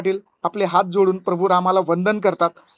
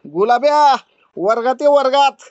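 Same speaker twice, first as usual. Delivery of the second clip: loud, higher call of a stern teacher; harsh teacher call; 4 semitones higher, 5 dB louder than usual. A narrator's reading. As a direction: shout him into class like a harsh schoolmistress. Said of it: "जादूबाईचा कर्कश आवाज". (कर्कश आवाजात हाक)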